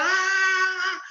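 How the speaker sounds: high yelping shout with a changed voice; a short mocking war whoop; mock battle cry. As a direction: echo the Israelites' scream. Mimicking Israel's shout again.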